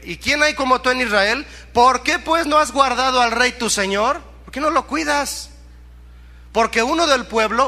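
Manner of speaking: high-pitched scolding questions; acted angry rebuke. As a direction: scold the negligent guard from afar. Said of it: "David se enojó". (reclamo enojado actuado, voz alta y aguda)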